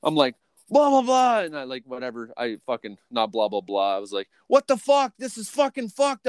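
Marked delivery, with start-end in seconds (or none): 0.7-1.4 high, loud blah-blah; loud nonsense rant, pitched way up; mock yelling. Mimicking an argument in the car. 4.5-6.3 high-pitched incredulous yell; outraged, voice pitched way up; retold angry outburst. Retelling own outburst in the argument.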